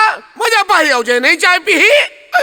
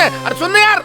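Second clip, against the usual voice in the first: usual speaking voice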